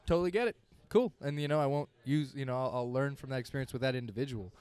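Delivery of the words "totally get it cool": higher, louder easygoing reply; breezy agreeable quote. Retold or imitated. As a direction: toss it off cheerfully and lightly. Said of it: "I'll be like".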